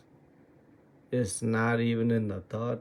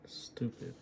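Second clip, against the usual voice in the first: whispered self-reproach; 8 dB quieter than usual. Real, not acted. Muttering at his own trades.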